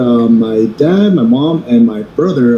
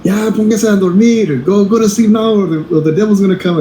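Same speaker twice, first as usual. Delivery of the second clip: lightly voiced dad, pitched up; scolding dad; 7 semitones higher than usual. Dad sending rowdy kids to sleep, mock scolding. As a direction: imitate a dad ordering kids to bed. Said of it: "the dad's like".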